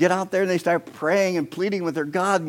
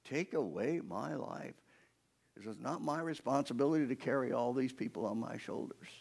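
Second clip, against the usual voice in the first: quiet replay; 3 semitones lower, 10 dB quieter than usual. Acted. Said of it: "That's angry".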